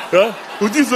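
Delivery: usual speaking voice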